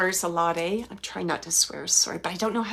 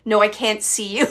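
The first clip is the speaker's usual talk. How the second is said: ordinary talk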